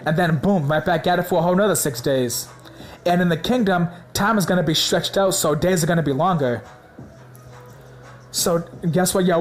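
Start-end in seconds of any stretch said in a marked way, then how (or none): none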